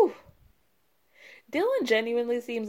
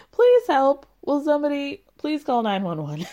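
mock plea for help; 5 semitones higher, 5 dB louder than usual. Imitating boyfriend begging for help.

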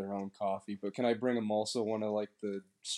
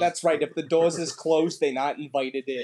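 put-on Boston accent; 3 semitones higher, 6 dB louder than usual. Character loud Boston dialect.

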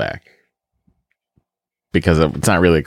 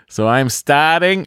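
put-on Boston accent; 8 semitones higher, slower than usual. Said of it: "the accent from my, uh, our home".

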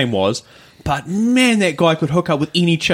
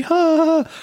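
high, dainty little laugh imitating a petite girl; 14 semitones higher than usual. Teller imitating the petite girl's usual high little laugh. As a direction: mimic her tiny normal voice. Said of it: "she talks like this normally".

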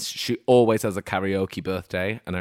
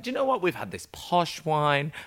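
posh mock pride; 7 semitones higher than usual. Tourists boasting about posh wine, mock smug. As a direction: savour it like rich people on holiday. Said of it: "We were like".

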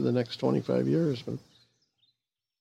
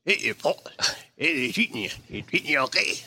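put-on Connery's Scottish accent. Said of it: "Only it was".

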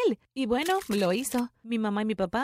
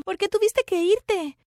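pleading; 10 semitones higher, 5 dB louder, slower than usual. Retold or imitated. Daughter pleads with returned mother.

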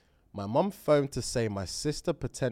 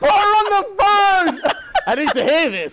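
high squealing laugh-talk; 12 dB louder than usual. Real, not acted.

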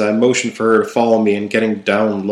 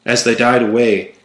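ordinary delivery, nothing marked

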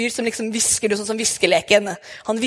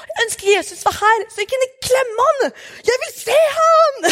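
put-on much higher, eager little child voice; child's voice; 14 semitones higher than usual. Six-year-old telling mum she wants to hug Jesus, high and eager. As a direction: plead like a small kid longing for Jesus. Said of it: "Syvåringen min og seksåringen min".

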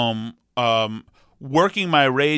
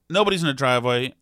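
normal talking voice

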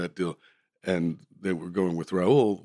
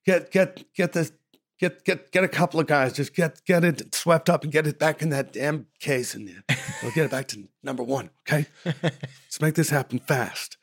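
high, loud, rapid-fire orders; an irritated director, mock yelled; impatient director orders; 8 semitones higher, 4 dB louder than usual. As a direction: hurry the crew along, fed up. Clint barking orders on set.

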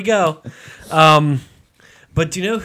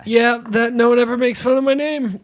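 mock crying fan; 8 semitones higher, 3 dB louder than usual.

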